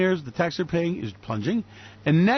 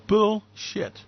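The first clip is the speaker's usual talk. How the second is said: ordinary talk, nothing marked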